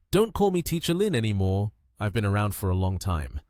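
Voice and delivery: ordinary delivery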